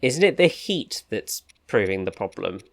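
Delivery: ordinary delivery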